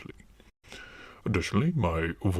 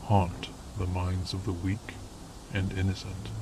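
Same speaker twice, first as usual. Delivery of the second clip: ominous narration; 5 dB quieter than usual. Acted. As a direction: announce the dark mission. Ominous foundation intro narration.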